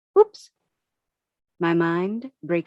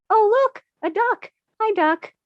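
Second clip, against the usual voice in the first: put-on much higher, sing-song baby talk; cooing at a duck; 12 semitones higher than usual. Distracted walker suddenly cooing a high hello at a duck. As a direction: coo at it like a toddler would.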